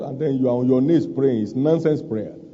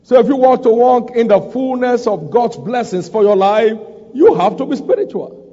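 raised, high-pitched sermon delivery; emphatic preaching; 6 semitones higher than usual. Real, not acted. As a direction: press the charge home to the congregation.

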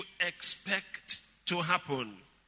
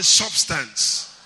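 louder, drawn-out call to repeat; 2 semitones lower, 9 dB louder than usual. Shown as a demonstration. Preacher getting congregation to repeat substance, out loud. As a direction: say it loud for everyone to echo. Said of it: "say it out loud".

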